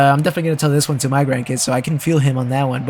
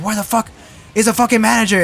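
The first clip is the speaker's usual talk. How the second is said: mock-angry customer, pitched high; higher-pitched mock outrage; retold angry demand; 6 semitones higher, faster than usual. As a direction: imitate an irate customer demanding the boss. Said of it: "he started getting super mad".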